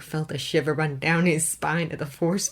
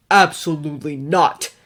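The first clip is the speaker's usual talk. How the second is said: louder, hard flat refusal; spat refusal; 8 dB louder than usual. A narrator's reading. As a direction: spit the refusal. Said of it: "Bruce spat".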